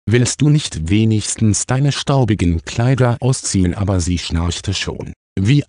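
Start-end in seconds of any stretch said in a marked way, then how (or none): none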